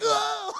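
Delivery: high, loud imitated yelp; mock startled scream. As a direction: scream back in mock fright. Startled hero screams back.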